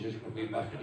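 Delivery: usual speaking voice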